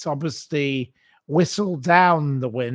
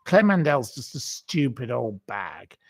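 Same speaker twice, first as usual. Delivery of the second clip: ordinary talk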